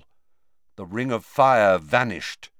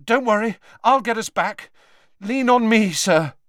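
high, louder urgent reassurance; anxious reassurance; 10 semitones higher, 6 dB louder than usual. A narrator's reading. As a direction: push through the fear to comfort. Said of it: "anxious about Dumbledore's extreme pallor".

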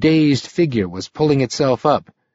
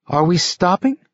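higher, light woman's voice; Sylvia's voice; 7 semitones higher than usual. A narrator's reading. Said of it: "It was Sylvia's voice now".

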